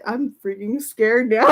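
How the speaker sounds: ordinary talk, nothing marked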